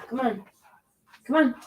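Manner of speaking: high-pitched urging to a pet; coaxing the cat. Real, not acted. Coaxing the cat to jump over.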